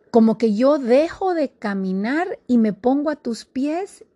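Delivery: ordinary talk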